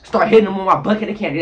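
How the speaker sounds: ordinary talk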